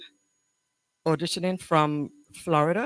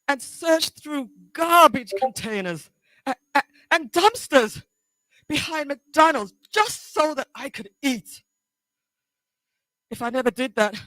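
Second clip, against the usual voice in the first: higher-pitched, halting confrontation with long pauses; anguished, accusing daughter; 13 semitones higher than usual. Acted. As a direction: confront Mom, close to tears, with bursts of force. Audition monologue, daughter confronting mother.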